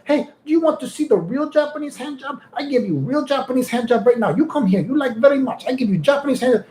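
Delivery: high mock grandma with broken English. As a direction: squeak it like a pushy grandma. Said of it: "she's like".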